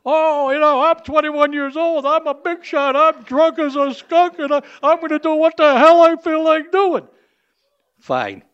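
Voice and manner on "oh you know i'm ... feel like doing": put-on high, whiny drunk brat voice; drunk kid voice. Limo driver mocking the drunk birthday boy, high whiny voice. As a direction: ham up the cocky drunk kid.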